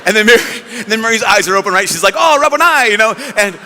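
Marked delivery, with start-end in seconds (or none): 2.2-2.9 high, loud exclamation; joyful recognition. Retold or imitated. Mary recognises her risen teacher, joyful cry. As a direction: burst out as you recognise him.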